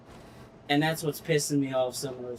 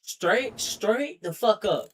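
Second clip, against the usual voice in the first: higher, slow, punchy; emphatic insistence; 6 semitones higher, 3 dB louder, slower than usual. Real, not acted. Gamer dismissing the tractor, emphatic higher. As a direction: hammer each word home.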